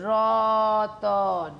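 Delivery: রতন drawn out very slowly, র-ত-ন one by one; split apart slowly. Shown as a demonstration. Teacher to students, drawing রতন out very slowly. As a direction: stretch রতন out slowly to show the split cluster. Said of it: "কথাটাকে ভাগ করছি".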